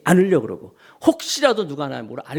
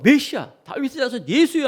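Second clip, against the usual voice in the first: calling out; 9 semitones higher than usual. Retold or imitated. Acted blind man calling to Jesus.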